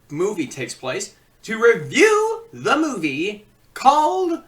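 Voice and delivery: loud, high, slow teasing reveal; dramatic showman reveal. Acted. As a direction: draw out the suspense before the title.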